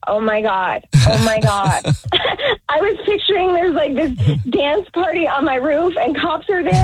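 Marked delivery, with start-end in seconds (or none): 1.9-2.6 real burst of laughter; real relieved laugh. Real, not acted. Relieved after prank reveal.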